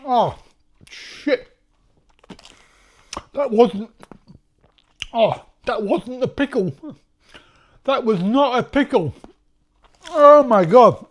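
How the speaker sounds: gasping through a burning mouth; mouth burning from spicy food. Real, not acted. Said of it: "My mouth is burning".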